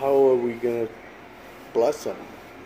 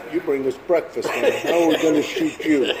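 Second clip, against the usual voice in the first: laughing; 4 semitones higher than usual. Retold or imitated. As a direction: quote the gang member while laughing. Gang members laughing at him.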